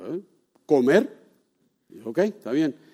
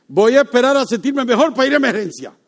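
loud, high mocking voice of the excuse; mocking quote; 11 semitones higher, 10 dB louder than usual. Preacher mocking a sick churchgoer's excuse, loud and scornful. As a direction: parrot their silly excuse, scoffing.

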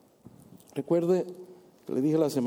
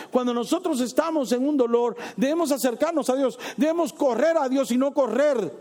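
high, louder pulpit exhortation; fervent preaching; 9 semitones higher, 5 dB louder than usual. Acted. Preacher exhorting the congregation, fervent and raised. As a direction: urge the flock forward.

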